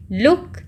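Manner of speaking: लुक with a small, short oo. Shown as a demonstration. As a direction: cut the vowel of लुक short. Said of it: "छोटा sound है double O का".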